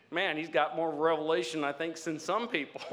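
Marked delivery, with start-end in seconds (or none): none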